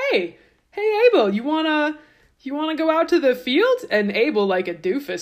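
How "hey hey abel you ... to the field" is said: high, sing-song fake friendly call; sly invitation. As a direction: lure him out sweetly, much higher.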